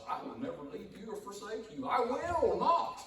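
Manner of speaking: high, loud, slowing to a ringing "not"; God's promise. Acted. Preacher voices God's promise to his flock, climbing high and loud. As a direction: swell up on "I will not".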